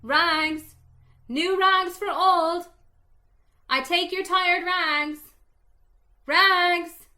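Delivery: ringing street vendor's call; ragman's call. Acted. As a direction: call it out loud and clear down the alley. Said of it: "calling in a clear tenor voice".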